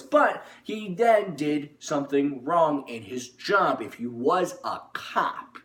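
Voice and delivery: ordinary talk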